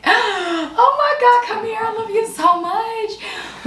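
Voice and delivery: high, slow, tearful-sounding joy; overjoyed. Retold or imitated. Parents hearing their baby can finally feed, high slow overjoyed cry. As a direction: stretch it out high like you can't believe the news. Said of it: "and we were like".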